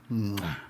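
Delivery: soft kissy lip smack; blown kiss smack. Retold or imitated. To the driver in the mirror, a teasing kiss smack. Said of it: "blowing kisses at him".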